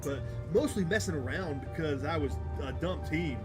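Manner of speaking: talkative bus passenger, casually bragging; chatty young prankster. Acted. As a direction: ramble like a carefree prankster telling stories. Voices talkative bus passenger in game.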